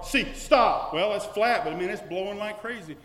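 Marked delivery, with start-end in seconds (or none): 0.0-0.9 commanding shout. Acted. Voicing Jesus rebuking the sea.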